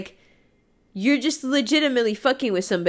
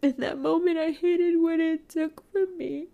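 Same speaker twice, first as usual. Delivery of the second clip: tearful, higher voice, words breaking; crying; 8 semitones higher than usual. Real, not acted. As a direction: push through real tears. Crying while confessing hurt.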